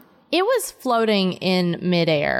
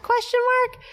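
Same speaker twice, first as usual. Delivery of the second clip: quirky questioning; 13 semitones higher, faster than usual. Real, not acted. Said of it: "you don't have to say question mark".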